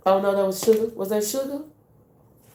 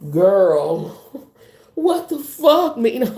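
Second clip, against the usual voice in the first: high, loud shocked cry, slowed down; shocked outburst; 5 semitones higher, 6 dB louder, much slower than usual. Real, not acted. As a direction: gasp it out in disbelief. Reacting to a card reveal.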